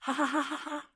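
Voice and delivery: villain's laugh ringing out, lightly acted; wild laughter. A narrator's reading. Demon laughing wildly from underground as it attacks, acted wild laughter. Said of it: "Wild laughter rang out from underground".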